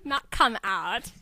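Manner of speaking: slow deadpan. Acted. Nelson's slow punchline.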